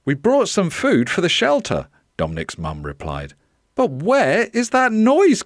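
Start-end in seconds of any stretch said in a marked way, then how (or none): 0.0-1.9 higher, louder storybook mum; animated mother's voice; visiting mum. Acted. Mum calls over noisy parrot. 3.8-5.4 high, loud question over noise; animated, high-pitched puzzlement; puzzled mum. Acted. Mum asks over the squawking.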